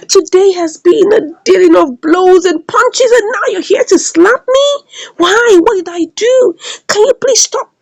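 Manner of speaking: high-pitched mock pleading; distressed protest. Acts out slapped man protesting.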